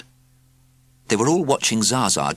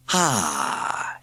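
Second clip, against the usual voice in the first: mysterious purr. A narrator's reading. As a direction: murmur it like a cat. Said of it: "she murmured in a mysterious, purring voice".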